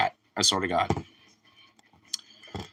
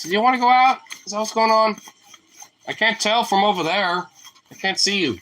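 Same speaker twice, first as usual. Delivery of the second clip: higher, raised questioning voice; high-pitched, exasperated pet talk; calling to a pet; 8 semitones higher than usual. Real, not acted. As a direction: ask the dog across the room. Calling to dog by the door.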